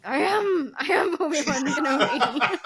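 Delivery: mock-delighted proclamation, breaking into laughter; imitated joy. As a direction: playfully imitate a gleeful actor announcing himself. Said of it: "the joy in his face that he's like".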